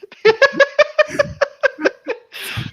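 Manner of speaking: loud high laugh; helpless laughing burst; burst of laughter. Real, not acted. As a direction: crack up out loud. Reacting to clown suit reveal.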